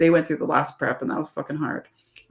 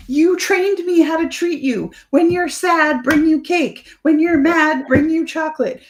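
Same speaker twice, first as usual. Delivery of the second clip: mocking husband's voice, much higher and louder; 12 semitones higher, 5 dB louder than usual. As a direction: squeak out the husband's excuses in a silly high voice. She voices husband's defence.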